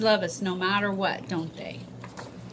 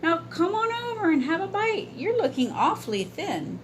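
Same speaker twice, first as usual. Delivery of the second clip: put-on young boy voice; 9 semitones higher than usual. Boy's voice in story.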